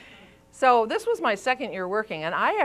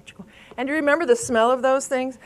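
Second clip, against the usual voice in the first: normal talking voice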